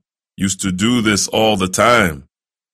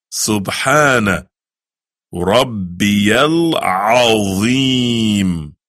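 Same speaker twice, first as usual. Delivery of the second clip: slow, drawn-out Arabic recitation; much slower than usual. Shown as a demonstration. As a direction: stretch every syllable of the prayer.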